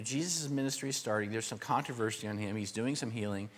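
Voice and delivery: normal talking voice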